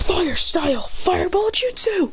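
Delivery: high, slow anime-style move call; mock attack call. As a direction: draw out the technique name, pitched high.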